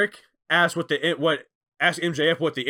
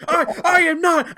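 high, loud indignant protest; freaking out; 13 semitones higher, 7 dB louder than usual. Retold or imitated. Jericho denying being called a prima donna, high loud outburst. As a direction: squeal the denial in outrage. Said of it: "Jericho starts freaking out".

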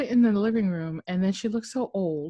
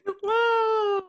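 surprised; 13 semitones higher, 4 dB louder than usual. Real, not acted. Reacting to the photo.

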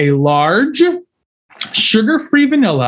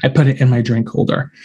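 ordinary talk

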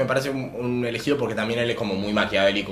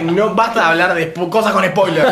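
laughing protest; 8 semitones higher, 7 dB louder than usual. Real, not acted. Friends cut off a spoiler.

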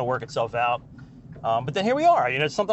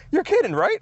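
incredulous, high and loud; 4 dB louder than usual. Retold or imitated. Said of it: "he says".

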